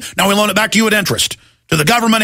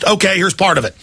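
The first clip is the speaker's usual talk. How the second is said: normal talking voice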